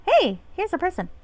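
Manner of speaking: high pixie voice. Acted. Pixie greeting a stranger, high pixie voice.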